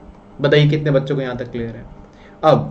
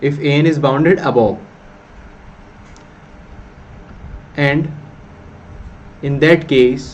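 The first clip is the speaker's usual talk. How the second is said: slow, deliberate dictation of a theorem; much slower than usual. Acted. Teacher dictates the theorem to students, slow dictation. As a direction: spell it out word by word for notes.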